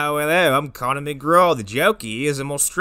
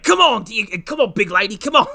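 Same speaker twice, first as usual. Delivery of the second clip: Australian wrangler; 7 semitones higher than usual. Retold or imitated. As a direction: urge the animal on mid-wrestle in Australian. Wrestling a boar as Irwin.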